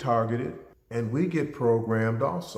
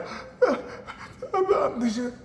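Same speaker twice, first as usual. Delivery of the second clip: high, imitated sobbing; mock crying; 12 semitones higher than usual. Daughter crying over her Christmas present, imitated sobbing. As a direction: put on a girl's high-pitched sobs. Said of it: "she's just crying".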